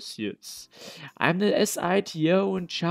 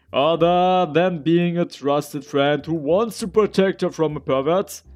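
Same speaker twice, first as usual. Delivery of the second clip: louder, indignant comeback; heated retort; 8 dB louder than usual. Acted. Protective friend accusing the doctor, loud and indignant. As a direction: throw it back at him loudly.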